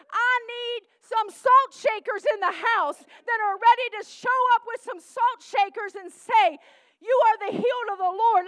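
rally the congregation; fiery church exhortation; full-force preaching. Real, not acted.

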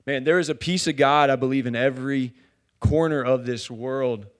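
loud, emphatic preaching. Real, not acted. Preacher declaring a piece of God in every corner, loud preaching. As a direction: drive it home to the congregation.

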